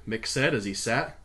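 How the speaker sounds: ordinary delivery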